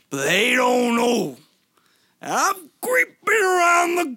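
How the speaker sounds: shouted, high hammed-up wrestler promo; wrestling promo. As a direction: roar it like a pro wrestler cutting a promo. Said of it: "can you cut a promo real quick".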